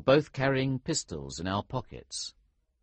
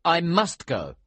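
raised, louder voice arguing back; 9 semitones higher, 7 dB louder than usual. A narrator's reading. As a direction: insist on it, higher and louder. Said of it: "I argued".

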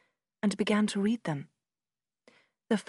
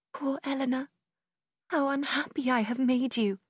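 tearful; 5 semitones higher than usual. A narrator's reading. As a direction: pity her sister through tears. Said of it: "Her eyes filled with tears".

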